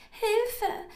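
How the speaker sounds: high, loud mouse squeal; squeaking mouse. A narrator's reading. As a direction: squeak for help like a caught mouse. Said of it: "quiekte die Maus wieder".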